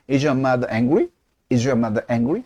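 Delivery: slow, "angry?" lifts at the end; yes-no question rising on "angry". Shown as a demonstration. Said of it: "의문사 없이 해볼까요". (끝을 올리는 예/아니오 의문문)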